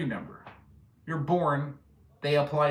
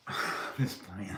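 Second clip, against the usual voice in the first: hushed joking aside; 7 semitones lower, 9 dB quieter than usual. Real, not acted. To viewers, owning up to the joke, hushed and playful. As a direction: drop nearly to a breath, teasing.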